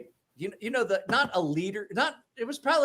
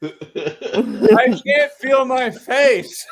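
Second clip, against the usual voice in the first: very slow, higher, laughing drawl; a laughing drunk kid, slowed way down; drunk laughing slur; 7 semitones higher, 8 dB louder, much slower than usual. Retold or imitated. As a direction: stretch it out through laughter, drunk. Imitating drunk city kid.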